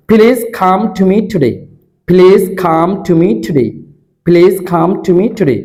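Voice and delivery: "প্লিজ কাম" with breathy ph and kh, the last take slow; aspirated drill. Shown as a demonstration. Teacher drilling aspirated please come for students. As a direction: puff air on every stop, slowing down at the end. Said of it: "তাহলে প্লিজ কাম".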